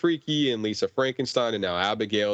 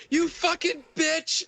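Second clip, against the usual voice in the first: high, slow shrieked curse as Abigail; shrieking yell; slower than usual. Retold or imitated. Podcaster quoting Abigail after the syringe stab, high shrieking yell. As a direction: screech it like a stabbed little girl. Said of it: "Abigail yells".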